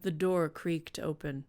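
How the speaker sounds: ordinary talk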